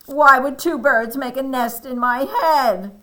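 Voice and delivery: high, loud storybook Elephant; exasperated protest. Acted. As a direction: demand an explanation loudly.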